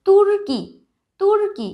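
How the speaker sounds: তুর্কি said high and loud with a clear r before কি; emphatic teaching demo. Shown as a demonstration. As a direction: call out তুর্কি, sounding the hidden r. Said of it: "কয়ের আগে একটা র এর উচ্চারণ আসছে".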